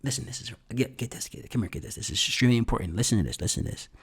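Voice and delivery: soft, urgent hush; low and confidential. Shown as a demonstration. Coach drawing the audience in to listen, low and urgent. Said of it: "bring 'em in low".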